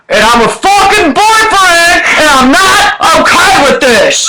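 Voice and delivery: high, slow, hammed-up screaming boyfriend; furious boyfriend. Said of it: "I'm gonna do a prank voice message".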